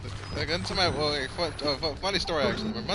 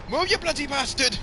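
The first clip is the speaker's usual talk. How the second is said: high, pitched-up English yell; mock English accent; 11 semitones higher, 4 dB louder than usual. Joking about an angry voice in your head, mock English shout. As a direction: shout it as an irate Englishman, hammed up. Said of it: "As a fucking Englishman".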